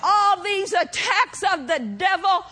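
ringing, drawn-out sermon delivery; full-force preaching. Real, not acted. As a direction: proclaim it to the congregation with mounting fervour.

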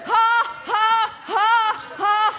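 spaced, shouted ha-ha's; defiant mock laugh. Preacher laughing down at the devil, defiant mock laugh. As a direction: throw each laugh at the devil.

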